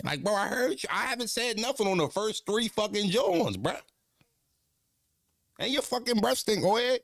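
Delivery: high-pitched mock-indignant kid; exasperated protest. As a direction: imitate a child complaining back in his head. Child's exasperated inner reply to scolding.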